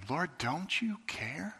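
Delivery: soft, slow hushed question; whispered plea. Retold or imitated. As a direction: breathe the question out quietly. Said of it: "this very same question".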